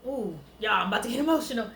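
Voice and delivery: low, softened, close to emotional; getting emotional. Real, not acted. Woman confiding in viewers mid-story, getting emotional. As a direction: drop low as feeling wells up.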